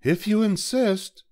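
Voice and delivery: a sparrow calmly accepting, very high. A narrator's reading. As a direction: pipe it up high like a small bird. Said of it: "said the sparrow".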